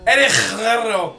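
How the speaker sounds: "Guerrero" loud and high with a harsh, overdone trill; mock rolled R. Viewer mocking the announcer, shouted "Guerrero" with overdone R's. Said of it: "rolling his R's when he says".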